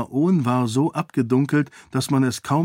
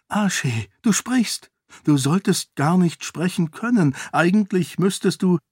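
much higher, tumbling wonder; astonished; 7 semitones higher than usual. A narrator's reading. Sarene marvels that her mad seon talks again, high and amazed. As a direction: blurt it out in amazement.

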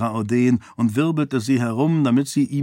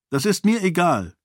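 higher, stubborn woman's voice; 7 semitones higher than usual. A narrator's reading. Sarene insisting to Raoden she doesn't care, stubborn. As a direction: dig in and won't budge. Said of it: "meinte sie beharrlich".

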